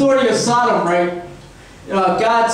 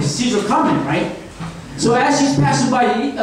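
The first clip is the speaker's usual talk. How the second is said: ordinary talk, nothing marked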